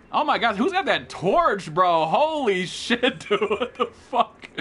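high, loud disbelief with laughter; loud, laughing shock; amazed outburst. Real, not acted. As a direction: blown away, drag out the expletives slowly. Shocked by a torch in game.

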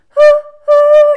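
high, loud hooting; train whistle hoot. Shown as a demonstration. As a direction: hoot like a train, short then long. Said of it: "here's our own train whistle".

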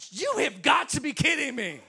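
high, loud mock disbelief; hammed-up exasperated shout; exasperated outburst. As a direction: retell it as an incredulous yell. Retold exasperation at being mocked.

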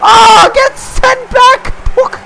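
high, loud shrieking; shrieking excited yell. Real, not acted. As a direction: scream the car on to the finish. Neck-and-neck race finish.

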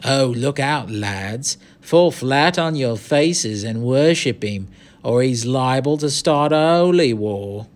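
slow, mocking gang lad's taunt; mock him in a rough British street accent; jeering. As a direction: play to your mates with drawn-out sarcasm. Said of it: "A concerted jeer arose from the circle".

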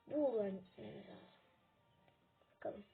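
normal talking voice

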